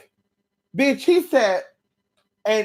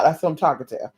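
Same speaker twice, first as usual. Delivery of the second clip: ordinary talk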